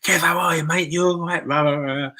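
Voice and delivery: put-on high, loud, clumsy Scottish accent; a friend's Scottish greeting, overdone and off; bad Scottish accent. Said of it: "I'm rubbish with accents".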